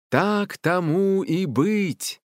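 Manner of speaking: high, very slow, drawn-out pronouncement; booming decree. A narrator's reading. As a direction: intone it like a spirit's verdict. Forest spirit pronounces its decree. (протяжный торжественный приговор)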